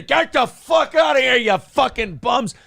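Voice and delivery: a booming furious roar, pitch way up; angry yell. Acted. Homeowner in robe chasing off campers: furious shout. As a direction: bellow like a man chasing bums off with a bat. Guy clubbing homeless with bat.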